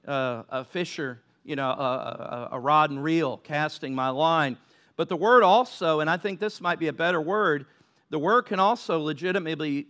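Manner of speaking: usual speaking voice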